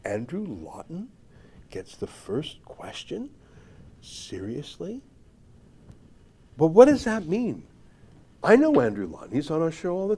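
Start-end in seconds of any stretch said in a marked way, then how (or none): 0.0-5.0 soft, slow incredulous questions; hushed disbelief. Acted. Host rereading the snobbish tweet aloud, quietly incredulous.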